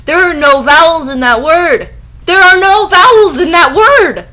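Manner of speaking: high, loud outraged shout; exasperated yell. Real, not acted. Reader yelling at the fanfic's spelling, exasperated shout. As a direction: build from annoyed to yelling. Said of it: "exasperated at the misspelling".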